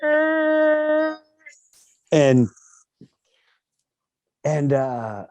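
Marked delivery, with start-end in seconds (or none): 0.0-1.2 high open-mouthed wail; mock wail of loss; imitated primal cry. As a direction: let out a raw high cry of grief. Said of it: "primal cries when you lose someone".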